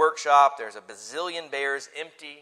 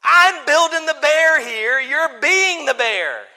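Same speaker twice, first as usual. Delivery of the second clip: put-on indignant kid; 10 semitones higher, 13 dB louder, slower than usual. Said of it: "Even that eight-year-old would know".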